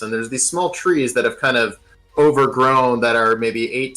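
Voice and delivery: ordinary talk, nothing marked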